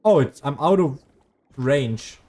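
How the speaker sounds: usual speaking voice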